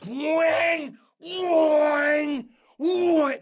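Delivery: high, loud shouted wang as each swing hits; loud mock clang of the Joker ride swinging; imitated ride noise. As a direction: belt out the clanging of the pendulum ride. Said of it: "and it goes".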